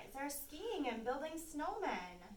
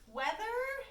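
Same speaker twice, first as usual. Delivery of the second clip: much higher, louder squeaky fish voice; puzzled fish; 12 semitones higher, 6 dB louder, slower than usual. Acted. Fish in the sea asking the children, high puzzled squeak. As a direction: pop up high as a baffled little fish. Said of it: "says the fish in the sea".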